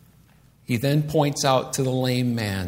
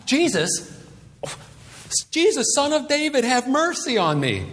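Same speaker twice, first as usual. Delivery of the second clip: high, urgent plea, hammed up; calling out; 10 semitones higher than usual. Blind man calls to Jesus for mercy, calling out. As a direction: cry out to be noticed, pitch held high. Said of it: "He calls out instantly".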